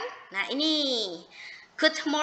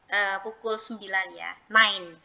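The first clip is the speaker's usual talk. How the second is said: normal talking voice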